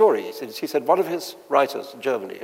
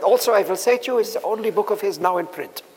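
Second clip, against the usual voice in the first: higher, mock German accent with a wry finish; heavy German accent; 7 semitones higher than usual. German publisher delivering the punchline, mock German accent. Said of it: "say in his heavy accent".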